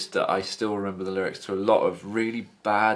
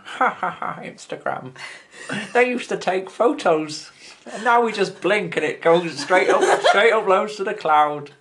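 mocking future voice; 8 semitones higher than usual. Future person mocking Instagram.

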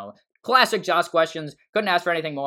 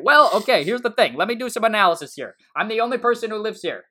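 exasperated rant; 7 semitones higher, 4 dB louder than usual. Retold or imitated. Retold own exasperated rant.

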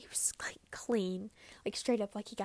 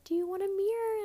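nurse quoted; 11 semitones higher, 7 dB louder than usual. Retold or imitated. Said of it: "They're like".

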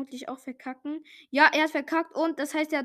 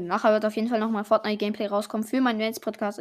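ordinary delivery

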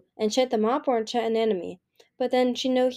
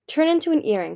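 higher, louder magical order; command; 7 semitones higher, 5 dB louder than usual. A narrator's reading. Anemone enchanting a tile, commanding. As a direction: order it firmly. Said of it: "she commanded the tile".

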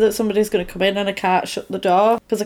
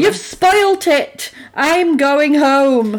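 high, slow whiny sulk, louder; sulky villain; 6 semitones higher, 4 dB louder, much slower than usual. Retold or imitated. Imagined killer quitting his boring game, high slow whine. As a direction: drag it out like a pouting child.

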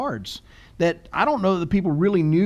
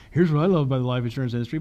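a fast, mock salesman line; mock cheesy pitch; 4 semitones lower, faster than usual. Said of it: "it sounds kinda, like, funny to say".

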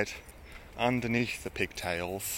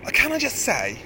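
prim woman's complaint; 10 semitones higher, 4 dB louder than usual. Retold or imitated. The woman lamenting to the arguing cyclists, high prim mock voice. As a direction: lay on her prim regret, stretching the end. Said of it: "The woman was saying".

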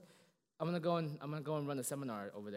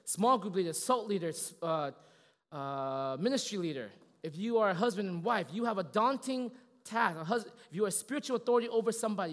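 louder, higher, slowed on key lines; forceful preaching; 5 semitones higher, 8 dB louder than usual. Acted. Preacher warning the congregation's leaders, forceful. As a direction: drive the warning home to leaders.

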